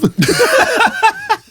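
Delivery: high, loud burst of laughter. Real, not acted. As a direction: crack up hard at your own joke. Big laugh after punchline.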